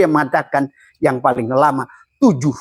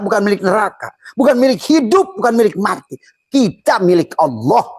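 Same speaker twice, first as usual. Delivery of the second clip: raised litany, hammering each bukan milik; fervent preaching; 6 semitones higher than usual. Acted. Preacher telling the congregation they belong only to Allah, fervent litany. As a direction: build the chant of contrasts with fervour.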